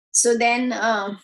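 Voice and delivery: plain reading voice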